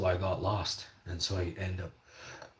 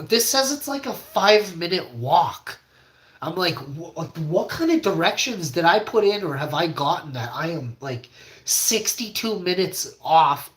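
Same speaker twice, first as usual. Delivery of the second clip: higher and louder, rising panic; pleading in disbelief, nearly crying; frantic disbelief; 7 semitones higher, 6 dB louder than usual. Retold or imitated. Upset, lost, disbelieving.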